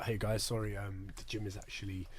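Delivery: soft mock Australian accent; fake-polite Aussie gym lie; quiet put-on Australian accent. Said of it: "I actually love your Australian accent".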